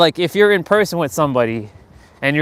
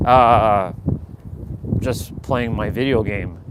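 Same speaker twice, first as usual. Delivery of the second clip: low, slow, uninterested answer; bored, monotonous reply; 5 semitones lower, slower than usual. Acted. Andre answering boring small talk, low and monotonous. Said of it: "they're boring, and they're monotonous".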